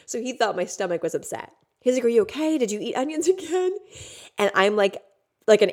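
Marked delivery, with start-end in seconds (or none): 2.1-3.7 high, rising mock-worried questions; amused imitation of a worried partner; imitated concerned partner. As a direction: voice the partner asking, pitch climbing.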